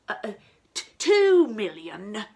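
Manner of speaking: a hint of a flustered nobleman; flustered archduke. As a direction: stumble over the offer. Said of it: "he said".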